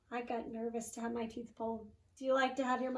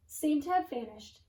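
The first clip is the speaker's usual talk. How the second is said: plain reading voice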